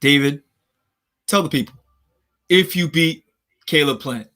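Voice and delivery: Brian Custer impression. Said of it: "That's, that's how he sounds".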